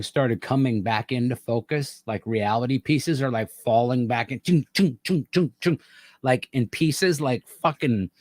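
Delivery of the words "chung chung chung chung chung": higher clunky "chung, chung" noises; mock mechanical clunks; clunking sound effect. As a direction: snap the pieces back in with each "chung". Imitating pieces snapping back.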